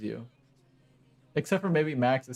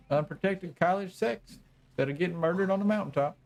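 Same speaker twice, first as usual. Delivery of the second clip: put-on old hillbilly accent; 6 semitones higher than usual. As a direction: say "getting murdered on the mountaintop" as the gloating old hillbilly. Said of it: "listened to the old hillbilly".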